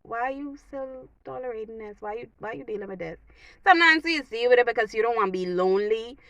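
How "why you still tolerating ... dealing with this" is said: soft, much quieter inner voice; hushed self-talk, asking why you put up with it; quiet self-questioning. Retold or imitated. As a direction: ask it under your breath. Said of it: "we never ask ourselves".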